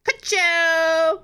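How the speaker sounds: loud Lightning McQueen shout. Acted. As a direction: yell it like a race car. Car voice shout.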